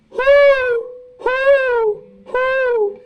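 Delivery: high hooting owl call, imitating Hedwig. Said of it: "My favorite Hedwig quote has to be".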